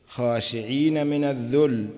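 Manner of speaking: chanted verse quote; verse recitation. Acted. Teacher quoting the verse before explaining it, recited. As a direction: intone it with a falling cadence.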